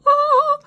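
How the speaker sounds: high, loud operatic yawn; sudden soaring operatic yawn cry. Shown as a demonstration. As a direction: belt out a yawn like an opera singer. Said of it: "I do, like, an opera yawn".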